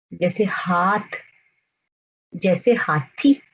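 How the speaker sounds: slow, with a clear h at the start of हाथ and हाथी; slow example words. Shown as a demonstration. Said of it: "इसकी आवाज जो है वो भी ह जैसी है". (धीरे, ह पर ज़ोर देकर)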